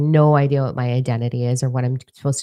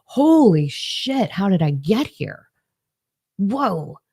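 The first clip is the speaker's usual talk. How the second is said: slow, high, stunned holy shit; awed disbelief, drawn out; amazed; 5 semitones higher, much slower than usual. Retold or imitated. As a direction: marvel at how far she has come. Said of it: "I'm like".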